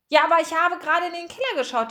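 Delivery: high, fast, insistent rush; urgent protest. A narrator's reading. Margarete told to stop, protesting urgently. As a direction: blurt it out before being cut off.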